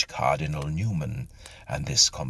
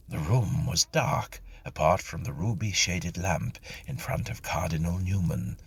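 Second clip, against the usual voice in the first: ordinary delivery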